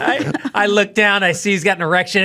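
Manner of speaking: normal talking voice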